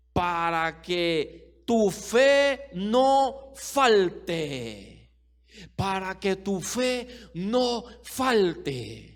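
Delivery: each word of "para que tu fe no falte" stretched very slowly; slow emphatic. Acted. Preacher driving home the verse to the church, very slow emphasis. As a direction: lean on every word so it sinks in. Key phrase slowed right down.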